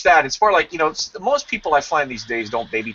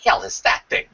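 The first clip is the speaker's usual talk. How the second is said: disgusted cat; 5 semitones lower than usual. Retold or imitated. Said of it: "or it's like".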